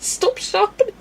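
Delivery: very high, pleading mock squeal; mock panic. Streamer pleading with runaway sharpener, high comic panic. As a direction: beg the machine in comic alarm.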